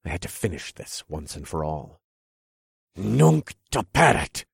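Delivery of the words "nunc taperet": shouted spell. Acted. Hero casts spell.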